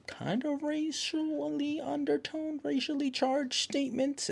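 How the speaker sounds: high, soft, drawn-out hedging; squeaky, stretched-out awkward phrasing; delicate euphemism. Real, not acted. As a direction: tiptoe through it in a high, careful voice. Delicately skirts around offensive lines.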